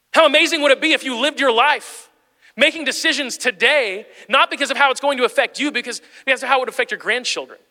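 urgent sermon delivery, easing at the end; impassioned preaching. Real, not acted. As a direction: challenge the congregation to imagine it.

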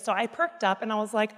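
ordinary talk, nothing marked

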